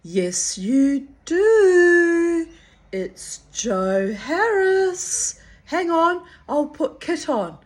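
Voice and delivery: loud, high teasing man's voice; playful Joe. Retold or imitated. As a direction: tease her gleefully down the phone. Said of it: "then he would say".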